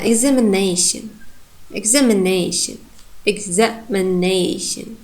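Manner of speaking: examination with primary stress on -na-, secondary on -ex-; demonstrating word stress. Shown as a demonstration. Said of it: "it's where it's clear that I'm pronouncing primary stress".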